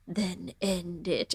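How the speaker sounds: slow, soft, sultry dragon purr. Retold or imitated. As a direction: breathe it low and seductive like a dragon. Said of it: "in this sultry dragon voice".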